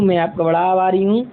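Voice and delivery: normal talking voice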